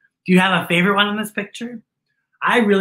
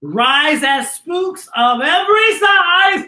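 slow, rising sing-song spooky read; slow spooky storybook rhyme; spooky verse reading; 7 semitones higher, 4 dB louder, slower than usual. Acted. Reads rhyming book verse.

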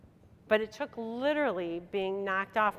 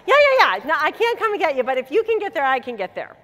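eager yes; 7 semitones higher, 11 dB louder than usual. Retold or imitated. Eager reply to niece.

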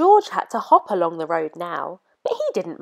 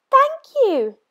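high, loud giraffe thanks; grateful giraffe; 8 dB louder than usual. A narrator's reading. Giraffe thanking George, high and grateful. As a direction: squeak out your thanks as the giraffe.

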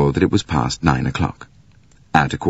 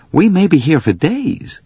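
restless; 11 semitones higher than usual. Acted. McQueen voice.

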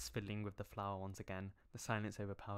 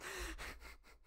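high embarrassed laugh; 6 dB quieter than usual. Real, not acted. Embarrassed by the text.

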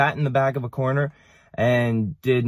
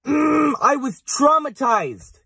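traumatized; 11 semitones higher, much slower than usual. Real, not acted. Punchline of twin story.

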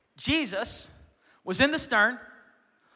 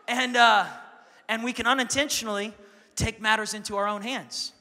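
ordinary delivery

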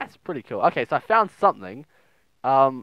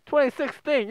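high, incredulous twenty sixteen; shocked disbelief; 11 semitones higher than usual. Real, not acted. Shocked by the date.

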